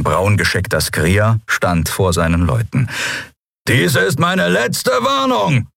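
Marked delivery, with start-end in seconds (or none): 3.7-5.7 high, slow bellowed warning; roaring. Acted. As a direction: roar the last warning slowly. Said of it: "brüllte er".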